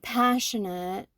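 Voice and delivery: ordinary delivery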